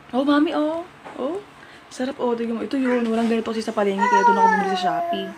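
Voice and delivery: lightly acted, eager offer of a snack; rehearsed sales pitch. Rehearsing the snack prank on mami, lightly acted coaxing. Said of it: "Practice muna tayo".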